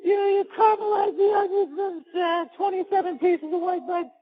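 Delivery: very high, strained helpless moan; squealed, hammed-up confession; mock moment of weakness. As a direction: blurt the recipe in high mock ecstasy. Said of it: "You're like".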